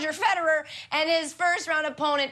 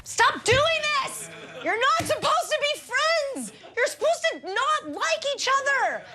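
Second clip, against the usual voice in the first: mock outrage; 11 semitones higher than usual. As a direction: rant at them like a betrayed fan. Mock rant at the stars.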